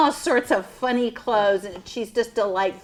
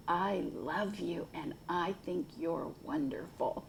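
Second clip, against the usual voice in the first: soft; 5 semitones lower, 13 dB quieter than usual. Acted. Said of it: "and he'd say".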